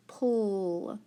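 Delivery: "pull" drawn out slowly; slow, held "pull"; separated final syllable. Shown as a demonstration. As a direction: stretch "pull" as a syllable of its own. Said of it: "P for the app".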